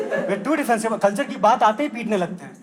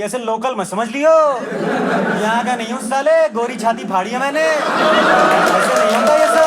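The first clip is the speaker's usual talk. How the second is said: swaggering brag growing louder; boastful doctor; 6 dB louder, slower than usual. Acted. Doctor brags about his USA degree to patients, swaggering. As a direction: puff up like a foreign-returned big shot.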